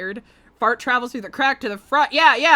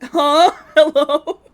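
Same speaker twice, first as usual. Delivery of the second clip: laughing hello; 5 semitones higher, 11 dB louder, much slower than usual. Pretend. Mock startled, laughing.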